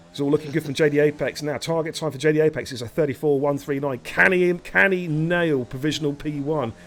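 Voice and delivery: excited qualifying commentary; live race commentary. Real, not acted. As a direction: call the lap like a race announcer. Calls a qualifying lap live.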